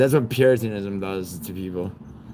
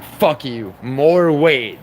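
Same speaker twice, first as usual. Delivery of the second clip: defiant, louder rising to a shout on more weight; defiant yell; 4 semitones higher, 6 dB louder, much slower than usual. Retold or imitated. Giles Corey defying his torturers, defiant shout. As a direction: spit the words back at them. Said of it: "He's like".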